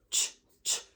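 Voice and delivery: "ch" with rounded lips, short bursts; spray out "ch" with pushed lips; spray bottle sound. Shown as a demonstration. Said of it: "protrude their lips while they produce it".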